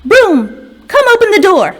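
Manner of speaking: high, loud order to the broom; fairies commanding. A narrator's reading. Fairies order the broom to open the door, high and loud. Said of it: "they said".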